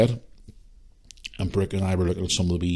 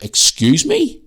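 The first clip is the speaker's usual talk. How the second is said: drawn-out, high, louder questioning; affronted disbelief; 5 dB louder, much slower than usual. Retold or imitated. Answering back to a rude shopper, affronted. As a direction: stretch it out high in retold indignation. Retells affronted reply in argument.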